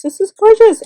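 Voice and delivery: high, loud burst of admiration; gushing delight. Real, not acted. Vlogger thanking her swap partner for the gift, gushing delight. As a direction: let it leap up high and loud in real delight.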